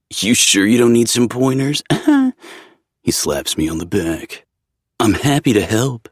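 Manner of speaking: playful friend voice. A narrator's reading. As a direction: tease him with a grin. Zev teasing.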